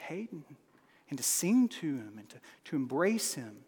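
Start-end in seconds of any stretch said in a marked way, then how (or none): 0.1-0.4 put-on soft, tender calling of a baby's name. Shown as a demonstration. Father softly names baby son. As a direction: hush it like cradling a newborn. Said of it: "it is instinctual to say".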